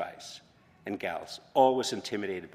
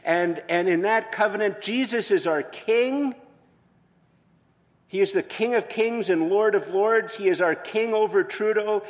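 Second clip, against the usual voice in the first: loud, high-pitched preaching at full force; predicación enérgica; 8 semitones higher, 8 dB louder than usual. Real, not acted. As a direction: proclaim it to the whole congregation, loud and high.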